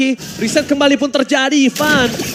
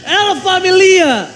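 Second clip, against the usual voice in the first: slow, drawn-out hype call; hyped team call; 4 semitones higher, 3 dB louder, much slower than usual. Real, not acted. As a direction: stretch the team name out. Caster hypes team name.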